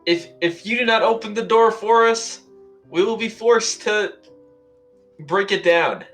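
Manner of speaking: threatening shout. Pretend. Player threatens to break door.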